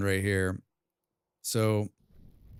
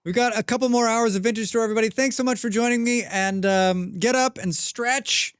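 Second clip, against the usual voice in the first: upbeat announcer voice; 12 semitones higher, 4 dB louder than usual. Real, not acted. Stream sign-off announcement.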